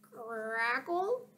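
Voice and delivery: soft, static-like crackle; radio crackle. Acted. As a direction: make it sound like radio static.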